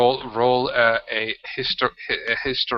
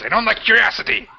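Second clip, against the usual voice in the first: high, loud sneering Duergar; a high contemptuous jab; mocking sneer; 10 semitones higher, 4 dB louder than usual. The other Duergar sneers at the curious strangers, high and loud. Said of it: "the other guy says".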